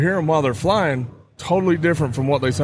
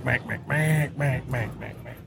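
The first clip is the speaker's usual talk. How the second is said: soft mallard clucks, fading to nothing; quiet mank mank like a hen mallard; soft duck talk; 7 dB quieter than usual. Shown as a demonstration. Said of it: "You're just gonna soft talk 'em in".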